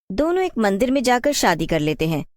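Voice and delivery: plain reading voice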